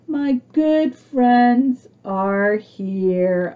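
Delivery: ordinary delivery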